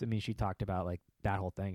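normal talking voice

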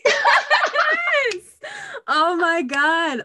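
laughing squeal of joy; overjoyed laughing cry; excited. Real, not acted. Reacting to the monologue.

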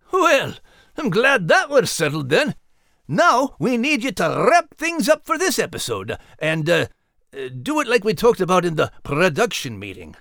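high, put-on character voice; high, businesslike little figure; high-pitched character, bossy. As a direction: chirp out orders in a high voice.

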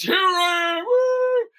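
mock Jesus making a flashy entrance; showy triumphant entrance. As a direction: show off like a celebrity arriving. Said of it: "If he came out".

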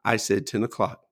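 low, soft, unruffled firmness; calm. Retold or imitated. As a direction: imitate an uncle who never needs to yell. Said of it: "just calmly".

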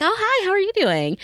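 high, sing-song hello; bubbly naive greeting. Retold or imitated. Young woman greeting a man in the lobby, bubbly and high.

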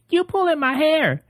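high female voice. Retold or imitated. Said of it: "I guess she's saying".

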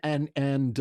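usual speaking voice

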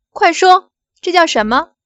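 harsh demand. Acted. Bank manager pressing the woman to say it, loud demand. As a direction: force her to answer now.

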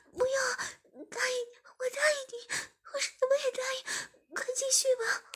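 high-pitched frantic pleading; tearful urgent begging; anxious, urgent. Acted. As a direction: plead desperately in a high, crying voice. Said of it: "志明马上着急道".

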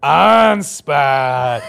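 loud laughing Ansbach; imitating the band in unison, loud and laughing; laughing chorus. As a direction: laugh through the name like a rehearsed chorus. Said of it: "like it was a rehearsed".

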